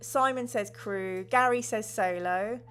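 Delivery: ordinary delivery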